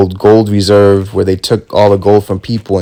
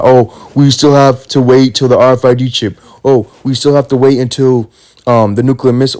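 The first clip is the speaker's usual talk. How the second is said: mocking whine; 4 semitones higher than usual. Mocking complacent believers.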